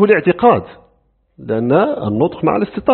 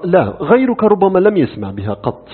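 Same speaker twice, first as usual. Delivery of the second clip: ordinary talk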